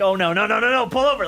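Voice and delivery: high, urgent no no no; mild mock panic. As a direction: hurry to make them pull over. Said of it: "You're like".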